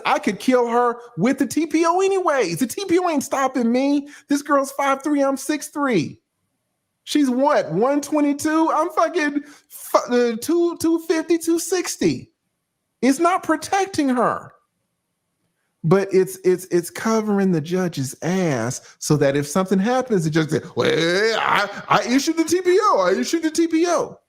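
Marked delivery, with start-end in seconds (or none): 0.0-14.5 much higher, wound-up disbelief; mocking the idea in a high voice; incredulous. Real, not acted. Ridiculing the idea of protection. 20.7-24.2 high, fast, flustered judge; mock whiny judge; defensive judge. Judge covering himself.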